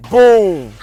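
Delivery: ordinary talk, nothing marked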